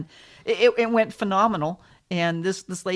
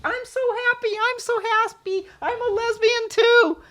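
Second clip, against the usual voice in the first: imitating elderly lady; 5 dB louder than usual. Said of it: "just telling everybody".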